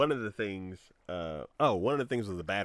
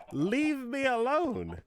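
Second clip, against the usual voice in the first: much higher, very slow, pleading; drawn-out whine; 9 dB louder, much slower than usual. Retold or imitated. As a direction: stretch it out like a fed-up kid. Fed up with promo emails.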